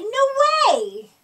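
high, loud scoff; a kid scoffing at the class; emphatic no way. A narrator's reading. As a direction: shut the idea down hard. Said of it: "he told everybody".

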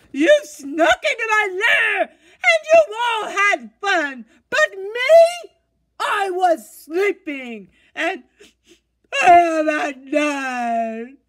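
loud, high, crying shout; wailing bear. Acted. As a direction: bawl it out like a hurt big bear. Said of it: "He whimpers and he moans".

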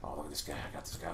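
usual speaking voice

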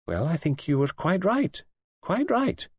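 high-pitched nurse voice, climbing on the repeat; approving nurse. A narrator's reading. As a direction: agree with conviction, pitched high.

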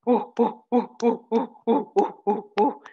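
short, rhythmic gorilla hoots; gorilla sound. Pretend. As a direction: hoot in bursts like a gorilla. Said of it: "Make a gorilla sound".

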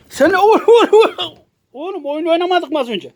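high, loud stammering o-, o-; startled stammer. Real, not acted. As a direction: caught off guard, stutter up high. Cat attacks, stammering high.

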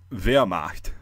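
Wehrmacht as VAIR-mahkt, R softened to "ah". Shown as a demonstration. Introducing the next word, Wehrmacht slow with German V. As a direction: begin Wehrmacht with V, slowly. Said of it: "the V sound, uh, and the W".

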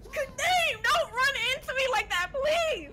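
high, loud frightened outburst; startled scream. Real, not acted. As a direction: jump and shriek in fright. Startled by teammate.

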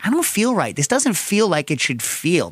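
high-pitched mock fretting; worried pregnant woman. Movie's pregnant woman complaining to her doctor, mock-worried. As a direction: imitate an uneasy patient, voice pitched well up. Acts out uneasy pregnant film character.

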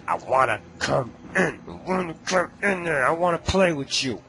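high, pleading put-on monster voice; mock creepy monster. Gamer voicing the monster at the door, high pleading creep. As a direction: beg to come in like a playful creep.

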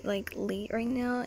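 ordinary talk

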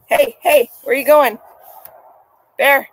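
calling the dog. Real, not acted. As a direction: call him back as he wanders off.

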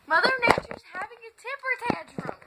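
emphatic repeat over the phone. Shown as a demonstration. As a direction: say it again so she gets it. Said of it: "She's doing what?".

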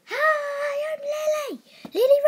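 loud, high cartoon girl greeting; bright shouted character intro; high squeaky girl voice. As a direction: pitch way up and call out your name. Child character introduces herself loudly.

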